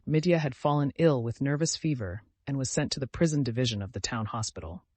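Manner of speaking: ordinary delivery, nothing marked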